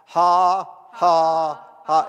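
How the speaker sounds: loud, separate, emotionless "ha"s; dry forced laugh. Retold or imitated. Preacher imitates a sick man laughing through symptoms, dry forced laugh. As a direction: fake a laugh with no feeling attached. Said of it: "he said it was the most dry".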